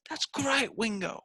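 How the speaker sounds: mock [name] voice. Mocking [name]'s voice.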